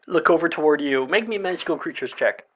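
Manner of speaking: ordinary talk, nothing marked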